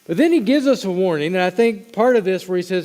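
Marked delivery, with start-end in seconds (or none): none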